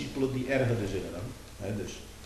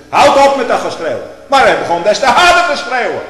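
much higher and louder, furious rebuke; shouting; 13 semitones higher, 19 dB louder than usual. Acted. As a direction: bellow it at full force. Preaching at full force. (luid schreeuwend)

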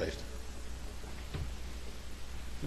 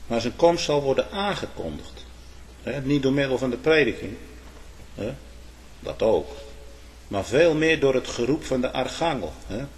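ordinary delivery

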